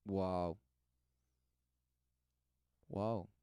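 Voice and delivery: usual speaking voice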